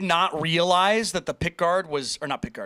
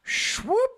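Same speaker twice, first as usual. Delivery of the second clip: high swoop. Retold or imitated. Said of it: "You could just be like".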